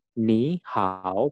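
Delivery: "Ni hao" with careful dipping third tones; third-tone demo. Shown as a demonstration. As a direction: teach the tones of "Ni hao", dip then rise. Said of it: "nadanya nada ketiga". ("Ni hao" dengan nada ketiga yang jelas)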